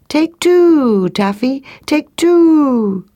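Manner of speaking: high, long cooing call, falling away; silly turtle dove cry. Acted. Turtle dove ignoring the lesson, silly high cooing cry. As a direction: coo it like a dove, stretching each "two". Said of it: "kept on saying".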